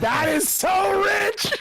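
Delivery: high laughing shout; cracking up while shouting. Real, not acted. Laughing hard at chat.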